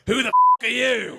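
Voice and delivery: loud, sudden snap; snapping great aunt. Retold or imitated. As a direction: bark it like a startled old lady. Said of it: "She turned into that shark from Finding Nemo".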